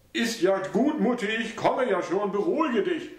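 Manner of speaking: calm his mother down; placating son. A narrator's reading.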